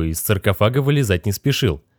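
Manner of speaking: usual speaking voice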